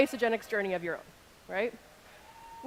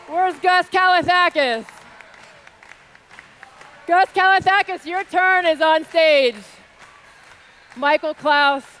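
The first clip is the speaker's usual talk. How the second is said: loud, raised voice to a big crowd; rousing shout-out; 5 semitones higher, 10 dB louder than usual. Real, not acted. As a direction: belt out praise from the stage. Shouted shout-outs from stage.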